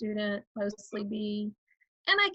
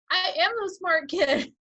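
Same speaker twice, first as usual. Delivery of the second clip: put-on a loud, higher, boastful kid's brag; proud smart kid; 8 semitones higher, 6 dB louder than usual. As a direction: show off like the class brainiac, half-joking.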